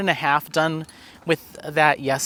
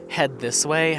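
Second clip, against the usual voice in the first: ordinary talk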